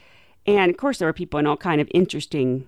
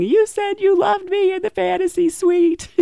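higher, indignant jilted woman; Vienna accusing; 12 semitones higher, 3 dB louder than usual. Retold or imitated. Vienna tackling the bachelor, indignant high accusation. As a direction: accuse him, much higher and louder. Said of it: "tackled by Vienna saying".

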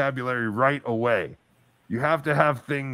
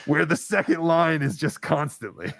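ordinary delivery

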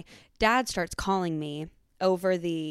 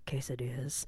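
hushed whisper, sneaking a food order; whispering a food order during a breakup; 5 semitones lower, 9 dB quieter than usual. Retold or imitated. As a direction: whisper so the crying boyfriend won't hear. Whispering order beside crying boyfriend.

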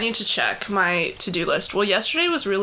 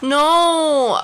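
dramatic whine; 6 semitones higher, 4 dB louder, slower than usual. Acted. Mock dramatic wail.

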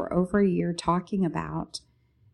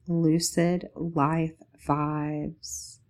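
usual speaking voice

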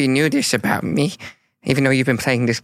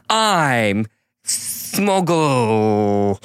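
slow heroic declaration; 3 semitones lower, much slower than usual. Acted.

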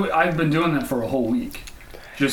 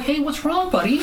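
high, softer fake innocence; mock innocent; 11 semitones higher, 3 dB quieter than usual. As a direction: play dumb sweetly. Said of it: "Acting all innocent".

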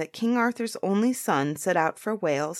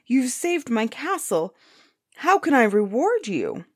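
high, effusive thanks; grateful cry; 6 semitones higher, 3 dB louder than usual. A narrator's reading. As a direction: gush with relief at being rescued. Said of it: "the giant cried".

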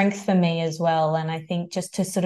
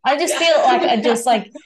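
emotional laugh; 6 semitones higher, 7 dB louder than usual. Real, not acted. Choked up after getting emotional.

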